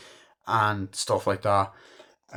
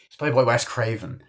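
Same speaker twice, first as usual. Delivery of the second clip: ordinary delivery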